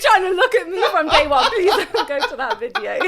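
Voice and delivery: high, loud, laughing through the words; embarrassed, giggly begging; laughing plea. Real, not acted. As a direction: plead through real laughter. Embarrassed plea about old video.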